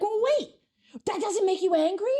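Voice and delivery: high, surprised disbelief; can't believe it doesn't bother them; a retold gasp of disbelief at coworkers; incredulous.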